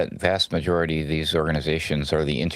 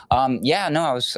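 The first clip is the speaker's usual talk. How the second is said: normal talking voice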